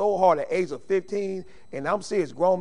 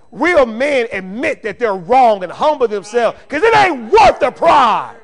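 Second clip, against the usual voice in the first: full-force preaching; 5 semitones higher, 13 dB louder than usual. Real, not acted. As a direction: preach it at full volume, driving every phrase home.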